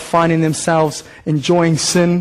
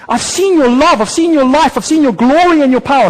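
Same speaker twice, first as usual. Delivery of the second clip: higher, fuller praise from David; a psalmist's quoted praise, a little louder; David's praise; 5 semitones higher, 4 dB louder than usual. Retold or imitated. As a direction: voice David lifting his praise. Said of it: "David goes".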